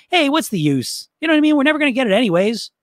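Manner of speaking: high, quick, breezy delivery; switched-up upbeat tone. Shown as a demonstration. Coach switching up the gloomy voice, high and upbeat. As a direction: toss it off lightly and fast. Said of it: "it's got a different feeling to it".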